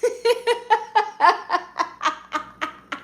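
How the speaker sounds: long high giggle fading out; can't stop laughing, let it trail off; real giggle fit, high and breathless; high helpless laugh. Real, not acted. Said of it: "that made me laugh".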